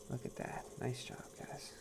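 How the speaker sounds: soft unvoiced whisper. Real, not acted. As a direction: breathe it out under your breath. Whispered praise at the wheel.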